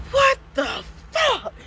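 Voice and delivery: high slow startled yell; boyfriend's retold shocked yell, high and slow; alarmed shout. Boyfriend spots man at window.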